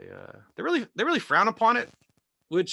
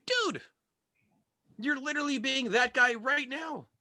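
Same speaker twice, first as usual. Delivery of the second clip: exasperated; 10 semitones higher than usual. Acted. Comic retorts to heckler.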